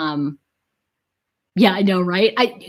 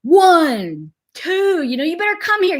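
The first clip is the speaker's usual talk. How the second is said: drawn-out warning count rising in pitch; counting parent; 7 semitones higher than usual. Retold or imitated. Imitating dad counting at his child, mock stern warning. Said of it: "you know how they count".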